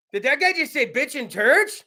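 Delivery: normal talking voice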